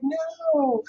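very high, soft drawn-out no; imitated squeamish no in a tiny high voice; mimicked refusal. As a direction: squeal a mock refusal, quietly. Mimicking husband's refusal to touch.

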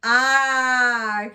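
"Ah" long and held, as in pop; middle sound alone. Shown as a demonstration. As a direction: stretch out the vowel "Ah". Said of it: "What's the middle?".

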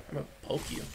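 unvoiced whispered threat. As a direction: breathe the threat.

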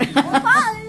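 giggling through the word; high laughing echo of Pie-tastic. Real, not acted.

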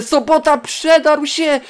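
high, loud, stretched-out call; excited shout. Real, not acted. Gamer watching Sobota break through late, excited shout.

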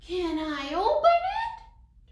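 put-on high, loud, drawn-out little girl's plea; eager child asking. A narrator's reading. Teresa asks her mother about the present, eager high voice. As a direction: ask high and slow, full of eagerness. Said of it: "Teresa asked her mother".

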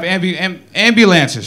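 stumbling on "ambulances", restarting after "ambu-" and "am-"; tongue-tied attempts at "ambulances"; tripping over word. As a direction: break "ambulances" into false starts, then get it out slowly. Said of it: "what a tongue twister".

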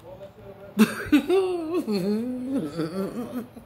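high, breathless laughing fit; helpless laughter. Real, not acted. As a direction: crack up unable to talk. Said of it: "me gana la pinche risa".

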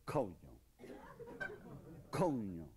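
"coño" said clearly with the ñ sound; careful pronunciation. Shown as a demonstration. Teacher showing how "coño" is pronounced. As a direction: model "coño" for learners. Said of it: "And it's pronounced".

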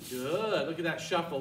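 ordinary delivery

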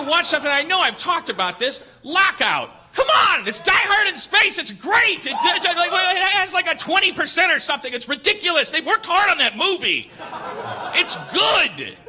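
loud, high, impassioned defense; passionate shouting. Real, not acted. Pleading with friends that Lockout is great, loud and high. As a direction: shout its praises with real fervour.

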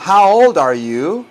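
put-on "How old are you" drawn out, rising on YOU; slow model question. Shown as a demonstration. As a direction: stretch the question for learners to imitate. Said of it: "Imiten mi voz".

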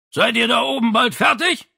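high, loud call from downstairs; father impatiently calling up from below. Acted. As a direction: holler it up the stairs. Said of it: "rief Vito wieder von unten herauf".

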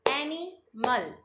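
Animal in two slow beats, pausing before the last syllable. Shown as a demonstration. Teacher leading two-clap drill, animal split in two. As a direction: break animal before its final "mal". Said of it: "हमें टू times clap करनी है".

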